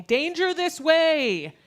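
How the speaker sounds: high, loud, drawn-out alarm call; blaring warning. Acted. Hot flashes as street signs warning of danger, blaring. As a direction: blare it out slowly like a siren. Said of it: "like blaring street signs saying".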